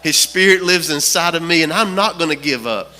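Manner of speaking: rousing sermon climax, driving each phrase home; impassioned preaching. Retold or imitated. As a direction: preach it with rising resolve.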